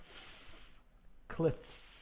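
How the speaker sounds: "f" breathed out, then "Cliffs" whispered; unvoiced "f" and "Cliffs" with a hissed s; voiceless f, cliffs. Shown as a demonstration. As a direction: blow the "f" softly, then "Cliffs". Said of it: "F sound".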